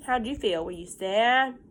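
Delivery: normal talking voice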